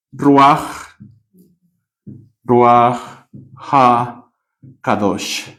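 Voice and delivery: "Ruach HaKadosh" said slowly with long pauses; Hebrew term. Shown as a demonstration. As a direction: pronounce "Ruach HaKadosh" word by word. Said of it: "estoy solo transliterando". ("Ruach HaKadosh" despacio, palabra por palabra)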